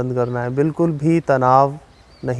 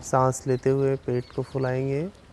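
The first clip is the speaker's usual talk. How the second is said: slow, measured relaxation guidance with long pauses; unhurried yoga nidra instructor, slightly softened; guided meditation; 8 dB quieter than usual. Real, not acted. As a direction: guide them calmly through breathing, drawing out counts and cues. Leads a yoga nidra session.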